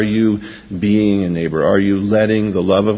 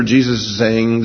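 normal talking voice

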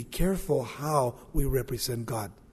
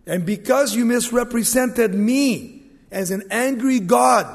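raised, higher preacher's voice as God; acted loud rebuke; 8 semitones higher, 9 dB louder than usual. Preacher voices God rebuking Moses for misrepresenting him, loud rebuke. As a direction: thunder the accusation, then land hard on the last word.

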